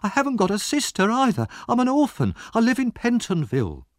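put-on very high, pleading boy's voice; greatly alarmed. A narrator's reading. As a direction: protest desperately, voice pitched high. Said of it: "greatly alarmed".

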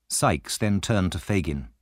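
plain reading voice

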